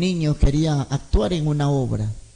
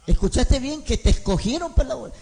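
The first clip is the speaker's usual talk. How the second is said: doubtful mother; 8 semitones higher than usual. Retold or imitated. Madre insiste, incrédula.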